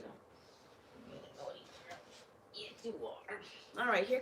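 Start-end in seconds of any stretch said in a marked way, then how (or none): 0.0-2.0 whispering. Real, not acted. Whispered to the dog. 2.5-3.4 high, cooing mock scolding; pet talk. Real, not acted. Scolding the dog sweetly.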